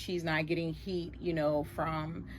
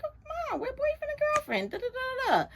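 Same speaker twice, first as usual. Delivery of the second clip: put-on gushing teen girl; faster than usual. Son's girlfriend gushing to mom.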